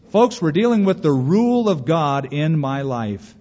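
normal talking voice

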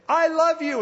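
put-on high, loud childlike call; little boy calling into the Alps. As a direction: shout it across the valley.

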